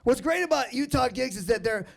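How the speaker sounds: ordinary talk, nothing marked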